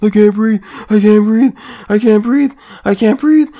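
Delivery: high, loud mocking whine of a panicked man; mocking whiny plea. Mocking the dying man's plea.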